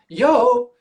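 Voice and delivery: casual yell. Retold or imitated. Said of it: "I went".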